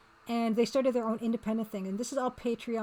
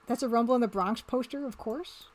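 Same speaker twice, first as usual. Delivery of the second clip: ordinary talk, nothing marked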